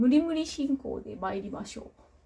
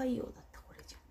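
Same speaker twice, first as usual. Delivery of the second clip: whisper; 8 dB quieter, much faster than usual. Real, not acted. Correcting herself under breath.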